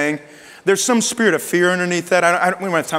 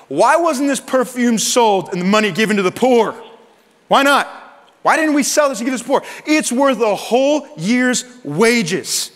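higher, louder, affronted protest; indignant objection; 7 semitones higher, 4 dB louder than usual. Retold or imitated. Disciple objecting to the costly offering, indignant protest. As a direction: imitate an outraged objector, pitched up.

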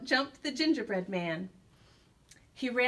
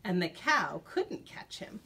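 usual speaking voice

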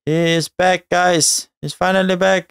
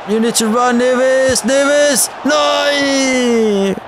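frustrated shout; 6 semitones higher, much slower than usual. Real, not acted. Gamer yelling at his footballer Neves to run, frustrated shout. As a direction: yell at the screen in growing frustration.

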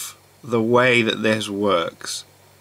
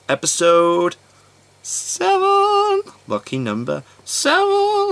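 sing-song hype, much higher; excited announcing; slower than usual. Real, not acted. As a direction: announce it like a game show host. Hyped episode announcement.

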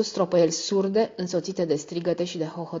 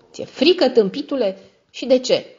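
mocking scorn; 7 semitones higher, 5 dB louder than usual. A narrator's reading. Sister mocks frightened brother.

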